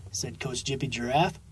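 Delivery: ordinary delivery, nothing marked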